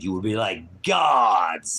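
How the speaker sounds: "You will be like gods" called out slow and loud; loud dragging proclamation; slow shouted quote. Acted. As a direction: boom the serpent's promise. Quoting the serpent's promise, shouted.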